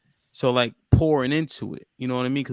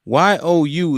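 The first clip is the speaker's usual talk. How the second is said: "Y O U" spelled loud, letter by letter; capital-letter emphasis on every letter of "Y O U"; emphatic spelled-out YOU; 4 semitones higher, 8 dB louder, slower than usual. Real, not acted. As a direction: punch each letter of "Y O U". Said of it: "shoulda capitalized that bitch".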